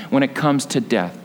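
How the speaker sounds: normal talking voice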